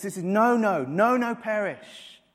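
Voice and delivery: preacher thundering the promise; emphatic preaching shout. Acted. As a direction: drive the double no home with full force. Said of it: "He says".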